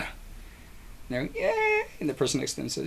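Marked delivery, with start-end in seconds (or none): 1.4-1.8 high, thin mock cheer; tiny crowd cheer, high up; small wave cheer. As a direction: squeal a little pretend "yeah". Cheer passing along the wave.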